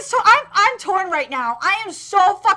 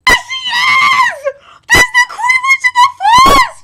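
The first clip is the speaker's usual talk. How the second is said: ecstatic screaming; 13 semitones higher, 9 dB louder than usual. Real, not acted. Fan seeing the Queen Witch appear, ecstatic screaming. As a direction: scream each phrase in overwhelmed delight.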